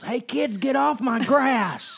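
put-on high, loud movie grump yelling at kids; grumpy old man yelling. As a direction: bellow like a cranky neighbor off his porch. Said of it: "any guy in a movie who yells".

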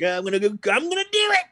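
hammed-up drunken resolve; imitating drunk people. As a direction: lightly play an eager drunk. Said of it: "people come home drunk".

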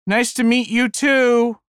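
high, slow, sugary farewell; cheery. Retold or imitated. Narrator calling after departing ladies, mock cheerily. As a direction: sing it out with fake cheer. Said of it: "I said after them cheerily".

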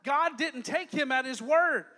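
ordinary talk, nothing marked

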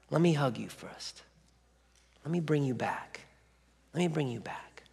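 soft, unhurried reassurance; tender comforting. Acted. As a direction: soothe someone in pain, gently and with pauses. God knocks, offers comfort.

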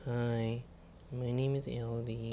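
dad lightly mimicking his daughter; a hint of a little girl's voice; squeaky falsetto mockery. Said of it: "That's not what I sound like".

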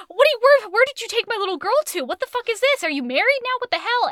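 high, loud, frantic father; dad demands where his daughter was, loud and frantic; outraged dad. Retold or imitated. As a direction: sputter at them in outrage. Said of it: "Her dad's like".

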